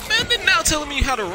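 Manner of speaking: frustrated outburst. Real, not acted. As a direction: rant at the screen. Frustrated at game.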